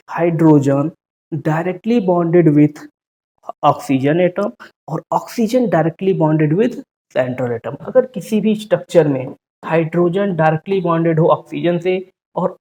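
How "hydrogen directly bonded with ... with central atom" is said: very slow, every word set apart; slow memory line. Shown as a demonstration. Teacher dictates the oxy acid rule slowly to students. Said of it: "एक line याद रखिएगा".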